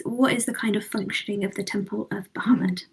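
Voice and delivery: unvoiced whispered question; whispering. Real, not acted. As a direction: whisper it to the table. Quiet aside question.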